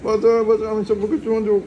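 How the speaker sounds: mock cry for help. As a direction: ham up a little one pinned down, calling for rescue. Voicing baby, mock cries for help.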